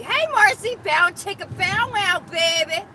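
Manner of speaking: high, loud, teasing bow chicka bow wow; flirty catcall. Acted. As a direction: play it like a cheeky come-on. Flirting with a passerby.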